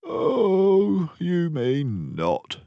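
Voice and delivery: sleepy, slow reply; a lightly voiced drowsy refusal; drowsy Dawson. Dawson drowsily turns down Basil's invitation, sleepy and slow. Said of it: "I replied drowsily".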